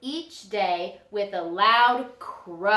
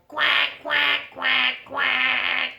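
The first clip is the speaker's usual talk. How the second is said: duck quacks; 3 semitones higher, 3 dB louder, much slower than usual. Acted. Mama duck quacking in song.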